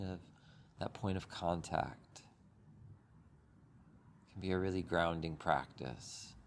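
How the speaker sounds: unhurried meditation guide with long pauses; calm, even voice, leaving space between phrases; guided meditation. Acted. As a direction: ease listeners gently through breath and body.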